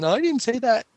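imitating lying kid. Boy caught lying to parent at night, high childish denial. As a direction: squeak out a kid's fib. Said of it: "And he's like".

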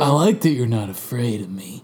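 low, soft quoted suitor; a lightly voiced, low and quiet man; Richard. Quoting Richard to co-hosts, lightly voiced low murmur. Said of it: "he says".

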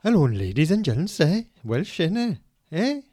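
high, loud mock Welsh accent for the mic; Gareth Thomas Welsh accent. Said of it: "Gareth Thomas would just like to say".

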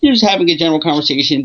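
normal talking voice

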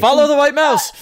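loud laughing shout; excited shout. Real, not acted. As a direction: yell it out with glee. Excited call to follow.

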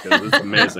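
real laughter breaking into the words, loud; laughing. Real, not acted. Game master delighted by a player's accent, laughing. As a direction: crack up while talking.

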